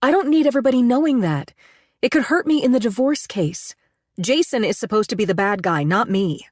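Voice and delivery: agitated pleading. A narrator's reading. Woman anxious that her lover is seen, agitated. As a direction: fret aloud about being seen, pitch up. Panicked narrator pleads with Aiden.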